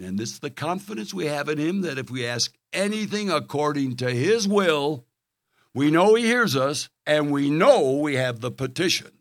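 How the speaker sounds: loud, high scripture proclamation; preaching at full force. Acted. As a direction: thunder the verse to the congregation.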